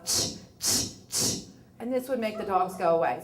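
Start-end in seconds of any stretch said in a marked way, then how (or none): 0.0-1.4 sharp unvoiced tongue clicks to shoo a dog; dog-scaring tch clicks. Shown as a demonstration. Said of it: "how you would get them to go away is you would go".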